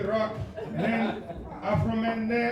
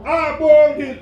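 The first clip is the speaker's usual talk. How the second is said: friends calling out; 6 semitones higher, 7 dB louder than usual. Retold or imitated. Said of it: "If your friends say to you".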